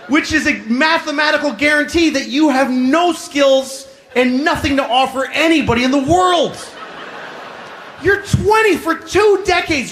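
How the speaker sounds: high-pitched, exasperated scolding; exasperated rant. Acted. Comedian scolding twenty-year-old counter workers, exasperated and high. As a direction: lay into them with mock outrage, pitched high.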